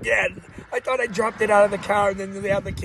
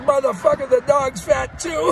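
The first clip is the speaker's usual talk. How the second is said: lightly voiced buddy, louder and higher; mock friend's jab; 6 semitones higher, 6 dB louder than usual. Friend ribbing him in the car, louder and higher. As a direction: toss out the crude joke louder and higher.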